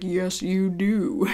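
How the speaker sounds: slow, drawn-out cartoon impression; Family Guy. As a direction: stretch each word out in a put-on character voice. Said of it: "Family Guy is like".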